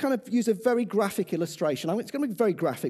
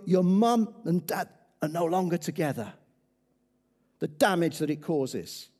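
ordinary delivery

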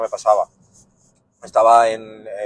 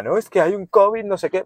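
high, louder mock alarm; mocking a worrier; 11 semitones higher, 6 dB louder than usual. Mocking people who fuss about covid, high mock alarm. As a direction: squeal it like a fussy alarmist.

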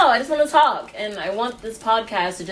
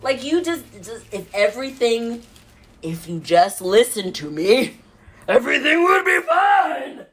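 higher, bossy, laughing control-freak rant; control freak alter ego; 5 semitones higher than usual. Pretend. Control-freak alter ego telling her family to listen, bossy and laughing. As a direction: boss everyone around half-laughing. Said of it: "I have this alter ego".

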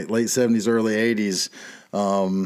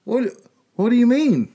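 mock disbelief; 11 semitones higher, 3 dB louder than usual. Acted doubter in mock argument.

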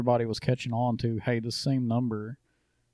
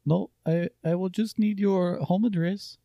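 put-on Indian accent, pitched higher; playful Indian tech support voice; 7 semitones higher than usual. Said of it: "Give me an Indian accent".

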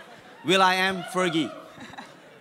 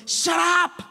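angry shout; 8 semitones higher than usual. Acted. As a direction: silence him harshly. Crowd silencing the beggar.

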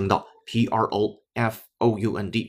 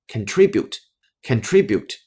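"contribute" with the second syllable TRI stressed, slowly; slow "contribute", beat on TRI, said twice; slower than usual. Shown as a demonstration. Teacher modelling the word for learners, "contribute" slowly stressed on TRI. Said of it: "重音呢是在第二个音阶".

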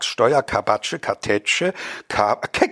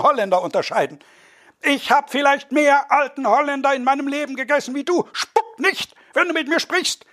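high, excited squabbling voices; raised voices of a quarrel over cheese; quarrelling dinner guests; 11 semitones higher than usual. Acted. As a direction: bicker like affronted bourgeois guests.

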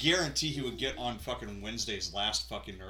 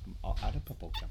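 gruff tinny action figure; 3 semitones lower, 7 dB quieter, faster than usual. Retold or imitated. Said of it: "Roddy Piper action figure".

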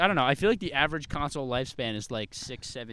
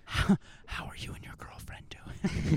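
slow whispered small talk; ASMR whisper; 4 semitones higher, slower than usual. Pretend. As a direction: whisper slowly like an ASMR barber.

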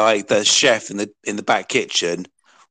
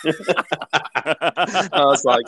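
laughing; 5 semitones higher, much slower than usual. Real, not acted. Laughing while telling.

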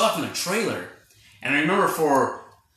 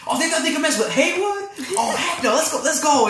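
high, loud, fired-up rallying cry; rally everyone to go, high and loud; imitating Emerald's fired-up reaction; 12 semitones higher, 4 dB louder than usual. Retelling a movie scene, imitating Emerald's high, loud rally. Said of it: "Just the way she said it".

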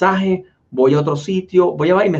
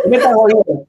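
incredulous; 5 semitones higher, 6 dB louder than usual. Retold or imitated. Incredulous reply to friend.

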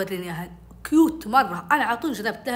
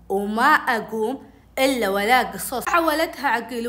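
ordinary talk, nothing marked

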